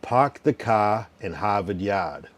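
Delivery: slow Boston drawl with dropped r's; Boston accent. Shown as a demonstration. Streamer giving caller the answer, Boston accent. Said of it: "the answer is".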